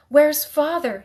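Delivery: put-on a scared child crying out; frightened cry. A narrator's reading. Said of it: "he cried".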